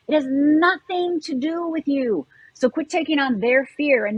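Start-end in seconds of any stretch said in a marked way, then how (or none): 0.1-2.2 slower, each word landed; slow it right down to drive it home; measured, emphatic repeat; slow, deliberate repeat. Shown as a demonstration.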